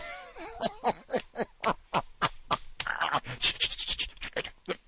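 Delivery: long high cackle breaking into choking; put-on helpless laughter from the fake caller; laughing fit. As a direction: laugh until it turns to sputtering.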